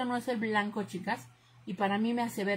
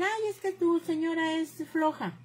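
high, drawn-out tattling complaint; high mocking singsong of criticism; mock complaining relatives; 8 semitones higher than usual. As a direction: imitate a critic's whine, pitched well up and slowed. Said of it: "Me dicen".

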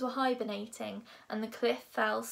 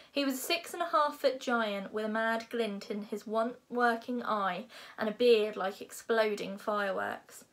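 ordinary delivery

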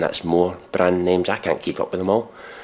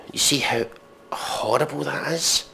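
ordinary delivery

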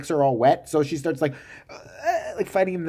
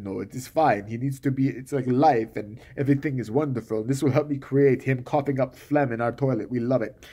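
lower, lightly voiced self-absorbed writer; mock smug husband; 6 semitones lower than usual. Husband brushes off his wife's worry, low and smug. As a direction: play the calm oblivious husband, low.

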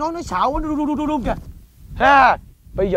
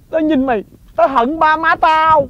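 slow bitter line; 3 semitones higher, slower than usual. Acted. Husband's bitter complaint.

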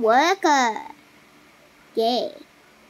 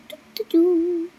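ordinary talk

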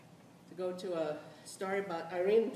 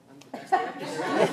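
laughing; 2 semitones higher, 11 dB louder than usual. Retold or imitated. Irene's retort, laughed out.

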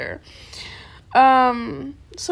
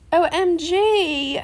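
slow, drawn-out gasping OMG; excited gasp; 4 semitones higher, 4 dB louder, much slower than usual. Real, not acted. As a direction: stretch it out in delight. Excited reaction to a fan.

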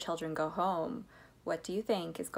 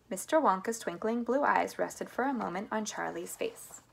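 ordinary delivery

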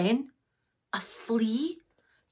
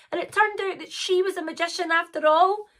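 usual speaking voice

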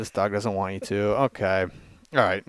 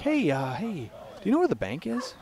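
higher-pitched fake innocent question; man asking directions; 7 semitones higher, 3 dB quieter than usual. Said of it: "He's like".

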